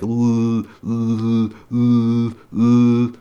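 stuck on L, building in force; demo stutter on L. Shown as a demonstration. Showing how L tripped him up, stuttering. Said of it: "I'd be like".

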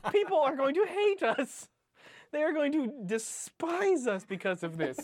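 high-pitched comic lament; laughing despair. Real, not acted. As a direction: wail it, half laughing, in mock despair. Mock despair at derailed podcast.

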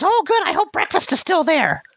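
very high, eager goblin voice; a hungry goblin piping up. Acted. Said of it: "one of them pipes up and says".

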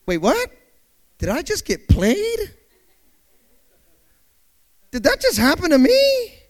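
mock-stunned, pitch climbing to the end; double take. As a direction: build from puzzled to incredulous. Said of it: "you have to do a double take".

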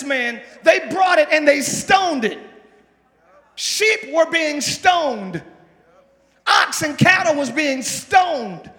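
loud, slow preaching cadence; preaching at full force. Acted. Preacher hammering the story to the congregation, loud and slow. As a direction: drive each line home to the congregation.

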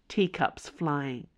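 ordinary delivery

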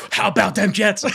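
excited fan cry, retold with gusto; hyped-up re-enacted cheer; all fired up. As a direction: holler it back like a rallying chant. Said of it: "I'm, like, all fired up".

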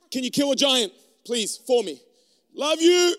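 half-joking plea. Pretend. Pleading with God.

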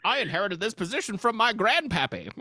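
put-on high, loud, snooty old exec; Hollywood producer voice. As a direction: brag like a spoiled heir producer. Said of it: "this Hollywood producer".

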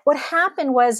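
ordinary delivery

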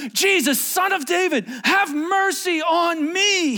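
high, drawn-out shout for mercy; crying out. Acted. Blind beggar crying out to Jesus over the crowd, shouted plea. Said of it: "he cries out".

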